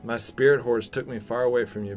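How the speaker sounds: normal talking voice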